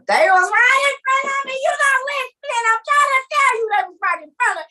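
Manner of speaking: very high, urgent retelling; frantic insistence. Acted. Dreamer telling somebody who is not listening, frantic insistence. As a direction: insist loudly at a high pitch. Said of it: "Could you imagine trying to tell somebody".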